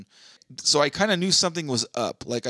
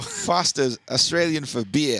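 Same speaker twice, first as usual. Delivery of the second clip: slow Aussie ad tagline, put on; hammed-up Australian pitchman; Australian accent; slower than usual. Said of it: "I'm a great Australian".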